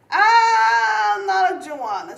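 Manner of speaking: high, loud, slow wail; a drawn-out joking howl; half-crying shout. Real, not acted. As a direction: let it out in a long high cry. Playful outburst about liking heat.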